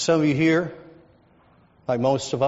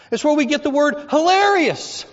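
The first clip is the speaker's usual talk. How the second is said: ordinary talk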